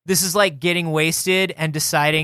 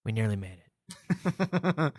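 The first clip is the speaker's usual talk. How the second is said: slow, lower defeated sigh; 7 semitones lower, 10 dB quieter, much slower than usual. Pretend. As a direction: sigh it out, crushed. Acted defeat.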